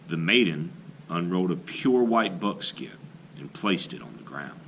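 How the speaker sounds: ordinary delivery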